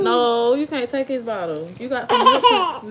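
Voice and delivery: laughing while telling off; laughing scold. Real, not acted. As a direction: scold through giggles. Scolding kid over bottle, laughing.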